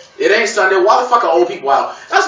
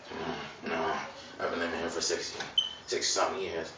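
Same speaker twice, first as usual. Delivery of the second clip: put-on low, soft mumbling old man; a gummy old-timer; 14 semitones lower, 20 dB quieter than usual. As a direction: mumble it like gums slipping. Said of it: "old people talk like that".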